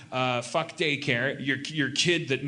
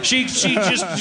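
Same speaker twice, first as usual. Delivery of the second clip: usual speaking voice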